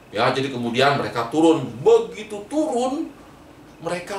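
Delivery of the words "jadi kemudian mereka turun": usual speaking voice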